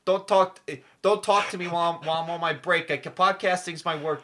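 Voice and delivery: loud, high, playful outrage; mock angry shout. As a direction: yell it like a cranky worker on break. Jokingly refuses to talk on break.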